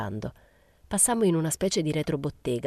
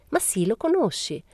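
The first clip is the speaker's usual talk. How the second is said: lilting, high-pitched gaiety; cheery, higher-voiced prod; cheerful; 7 semitones higher than usual. A narrator's reading. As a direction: remind them merrily, lifting the pitch. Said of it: "disse Momina allegramente".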